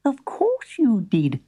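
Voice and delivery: high, slow squeak; squeaky spider. Acted. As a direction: squeak it kindly. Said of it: "squeaked the spider".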